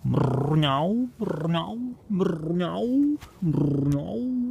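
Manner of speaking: drawn-out, rising high cat meows; meowing like a cat. Pretend. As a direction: meow slowly like a cat. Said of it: "I'm gonna try cat".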